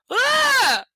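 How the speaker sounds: a loud scream of disgust. Real, not acted. Reader screams at the creepy line, disgusted scream.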